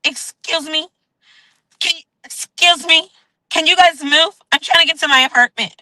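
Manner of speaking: high, pushy "excuse me" voice; lightly voiced impatient neighbor; a put-on high lady pushing through a crowd. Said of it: "'cause she was like".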